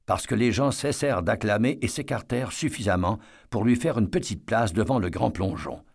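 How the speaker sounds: ordinary delivery, nothing marked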